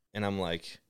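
usual speaking voice

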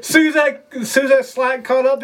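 laughing through the words, pitched high; can't hold back the laugh; real laughter breaking into the story. Real, not acted. Laughing while telling the fall.

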